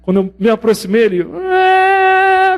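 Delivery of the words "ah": a high, stretched newborn wail; imitated baby cry. Doctor retelling his nephew's birth, imitating the baby's high cry. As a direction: mimic the baby starting to cry. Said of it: "começou a chorar de novo".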